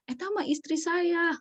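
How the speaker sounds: high-pitched, lightly put-on father deflecting; father blaming wife. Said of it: "katanya gitu".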